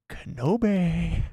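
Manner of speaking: slow, drawn-out, hammed-up Sith menace; Darth Maul growl. Maul impression for Pam, slow menacing name. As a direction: stretch the name out like a threat. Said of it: "My Maul doing the Kenobi scream impression".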